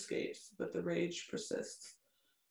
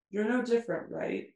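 higher, louder bitten-out accusation, mildly acted; biting, voice cracking; 5 semitones higher, 5 dB louder than usual. A narrator's reading. Narrator voicing her confronting him at gunpoint, mildly biting. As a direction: bite it out with a touch of upset. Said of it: "I bite out, my voice cracking".

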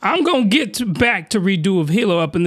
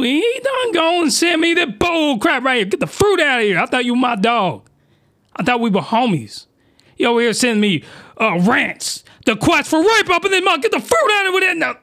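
triggered rant; 7 semitones higher than usual. Real, not acted. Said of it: "Sorry, I'm getting too triggered".

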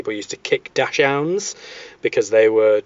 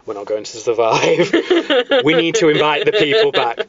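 mock panicked parliament; 3 semitones higher than usual. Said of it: "that's when they realised".

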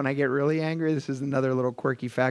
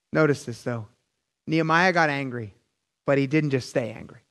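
ordinary talk, nothing marked

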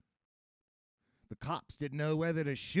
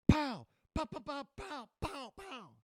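gunshot sounds; 11 semitones higher than usual. Acted. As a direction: mouth gunfire, fading away. Police gunfire imitated.

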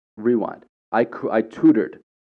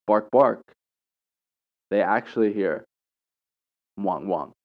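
ordinary talk

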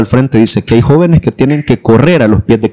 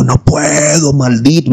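slightly raised, drawn-out mock demonic cry; imitated possessed voice; 4 semitones higher, slower than usual. Possessed brother answering the leader, imitated changed voice. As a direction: lightly act out a changed, possessed voice. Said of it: "Y la voz le cambió".

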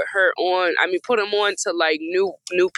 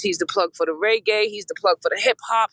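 ordinary delivery

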